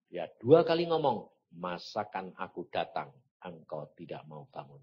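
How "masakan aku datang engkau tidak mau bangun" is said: low, soft voice of God; quiet divine reproach. Retold or imitated. God's reproach, retold.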